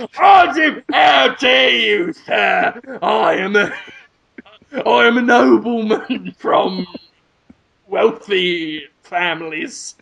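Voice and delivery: hammed-up, slow mock nobleman; affronted self-styled gentleman, drawing out the words; indignant gentleman. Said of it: "And we'll go".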